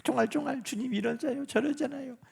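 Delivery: 쫑알쫑알 softly babbled complaint; mock chatter. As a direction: prattle like a fretful child, softly. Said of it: "우리가 막".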